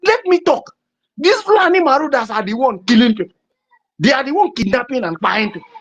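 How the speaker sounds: high, pleading protest that ends in a shout; mimicking a man fighting to speak on TV; impassioned outburst. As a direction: demand to be heard, rising to a cry of why. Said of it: "the man was like".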